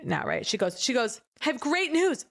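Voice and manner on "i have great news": high-pitched mock elation; hammed-up happy announcement; very excited. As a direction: imitate an elated accountant with big news. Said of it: "why else would she be so excited saying".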